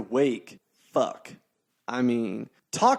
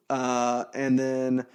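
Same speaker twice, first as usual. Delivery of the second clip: ordinary talk, nothing marked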